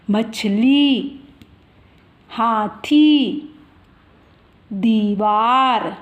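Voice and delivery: मछली, हाथी, दीवार each drawn out very slowly; धीरे धीरे खींचकर; slow example words. Shown as a demonstration. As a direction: read the words slowly for young learners. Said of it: "जैसे ये दीपक".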